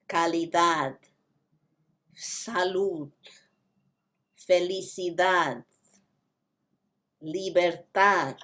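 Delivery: "Calidad, Salud, Felicidad" with soft th-like d's; "Calidad" se "Dados" tak naram d; soft Spanish d. Shown as a demonstration. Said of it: "soft T H sound".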